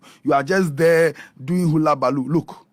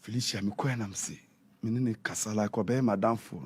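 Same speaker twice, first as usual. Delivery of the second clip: ordinary talk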